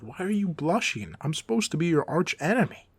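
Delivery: loud, high-pitched incredulous protest; exasperated outburst. Acted. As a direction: scold them in disbelief, voice jumping up. Flustered villain scolds blushing hero.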